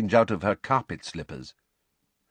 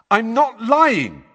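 high, loud, slow insistence; loud protest; 8 dB louder, slower than usual. Acted. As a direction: protest loudly, stretching the words. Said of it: "said Harry loudly".

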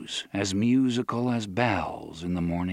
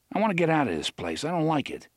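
restless Eddie; 5 semitones higher, faster than usual. Acted. Eddie wants to leave.

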